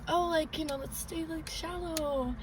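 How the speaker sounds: high, soft, drawn-out casual suggestion; mock-casual excuse. Scared swimmer suggesting shallow water, mock-casual. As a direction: play it light and airy, trailing out slowly. Acting out her paranoid excuses.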